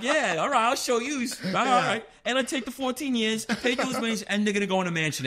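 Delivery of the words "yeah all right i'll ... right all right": high, laughing mock defiance; spiteful retort. Rich associate answering the government's seizure, spiteful. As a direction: voice a spiteful comeback, pitched up. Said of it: "He's like".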